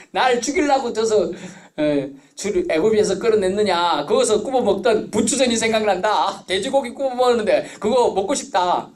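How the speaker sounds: high, loud mock grumbling; hammed-up whine about the old food; grumbling crowd. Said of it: "투정 부리고 하니까". (높고 큰 소리로 투정 부리는 흉내)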